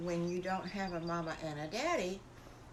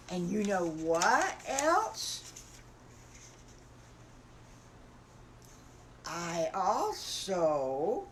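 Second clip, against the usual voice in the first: slow, louder little wolf, drawn-out words; lightly voiced wolf with big news; little wolf confiding; 3 dB louder, much slower than usual. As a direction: build suspense with stretched words.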